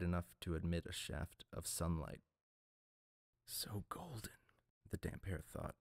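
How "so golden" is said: breathless whisper, high and hushed; awed whisper. A narrator's reading. Broken priest whispering at the sunlight, awed whisper. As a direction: whisper it in awe at the light.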